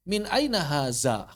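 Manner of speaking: slow "Min aina haza" with buzzing z; mispronounced dh as z. Retold or imitated. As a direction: imitate a learner's wrong "haza". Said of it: "Kok haza sih?".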